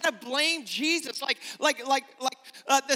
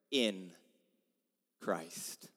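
hushed emphasis; 10 dB quieter than usual. Shown as a demonstration. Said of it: "it is the phrase".

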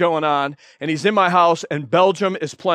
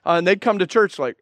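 normal talking voice

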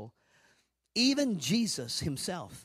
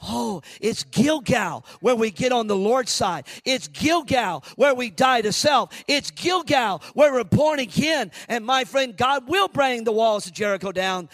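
shouted preaching, pitched up and louder; fiery preaching; 5 semitones higher, 9 dB louder than usual. Acted. Preacher shouting to the congregation about Gilgal, fiery. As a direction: preach it out to the back row.

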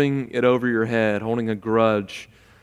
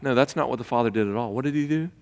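ordinary delivery

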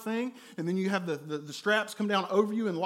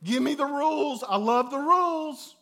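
mock enthusiasm; 6 semitones higher, 5 dB louder than usual. Sarcastic eager rule lover.

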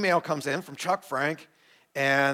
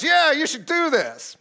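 much higher, slow voice of the approving assessor; assessor's approval; 11 semitones higher, 4 dB louder than usual. Retold or imitated. Assessors telling the chosen few to plant, delighted approval. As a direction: announce the green light like a delighted judge. Assessors giving green light.

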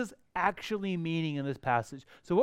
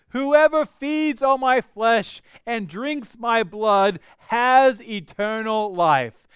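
slow, weighty verse read; slow, louder solemn reading; solemn scripture reading; 2 semitones higher, 5 dB louder, slower than usual. Acted. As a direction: lean into each word of scripture.

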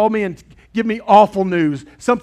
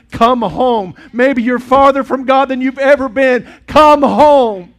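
louder and higher each time; building to a shout; 7 semitones higher, 8 dB louder, slower than usual. Real, not acted. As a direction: rise into a full preacher's cry. Sermon climax, building plea.

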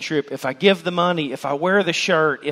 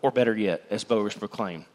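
much softer and lower; hushed assurance; 9 semitones lower, 9 dB quieter than usual. Real, not acted. Preacher assuring hearers salvation has found them, soft and low. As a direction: let it settle quietly. Closing promise.

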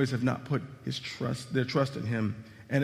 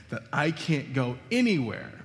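ordinary talk

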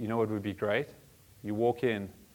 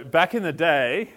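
mock old-timer; 2 semitones higher, 8 dB louder, slower than usual. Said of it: "no, I'm joking".

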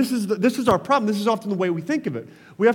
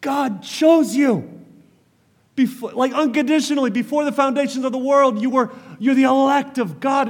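higher, earnest voice of a pastor reassuring his flock; Calvin reassuring; 6 semitones higher than usual. Retold or imitated. Calvin reassuring his people that God chose them, higher earnest plea. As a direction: lift the pitch and plead gently, look at the scriptures. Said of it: "what Calvin was doing was he was saying".

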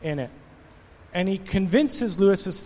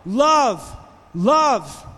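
mock desperate shout, higher and louder; shouting love; 5 semitones higher, 6 dB louder, much slower than usual. A grieving father shouting at the townsfolk, pleading. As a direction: cry the word out to the crowd. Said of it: "he's shouting".

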